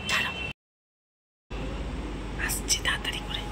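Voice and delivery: hushed whisper while hiding. Real, not acted. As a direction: whisper so the dog can't hear.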